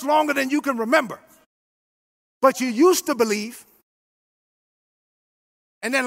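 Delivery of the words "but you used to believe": ordinary talk, nothing marked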